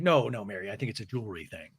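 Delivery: low, hushed correction; Heather. Retold or imitated. Woman gently correcting her friend, in a low hushed voice. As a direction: drop pitch and volume, trailing off quickly. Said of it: "And Heather's like".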